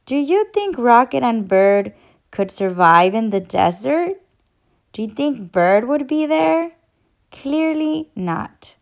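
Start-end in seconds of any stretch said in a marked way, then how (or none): none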